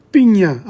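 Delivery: Piña with the ñ sound, pee-nya; Spanish ñ. Shown as a demonstration. Teacher demonstrating the ñ in Piña. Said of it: "the N is not like the G N in Italian".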